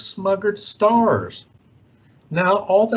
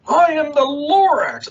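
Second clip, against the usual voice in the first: raised, higher Lorax voice; 8 semitones higher, 4 dB louder than usual. Acted. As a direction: announce it boldly as the Lorax. Lorax announces himself loudly.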